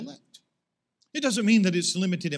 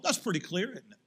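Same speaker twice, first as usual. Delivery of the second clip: ordinary delivery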